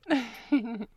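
loud teasing giggle; bright playful laugh. Acted. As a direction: giggle at his embarrassment. Teasing the listener.

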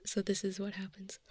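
sharp gasp for air after a line; gasping for breath. Shown as a demonstration. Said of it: "I'll get out of breath".

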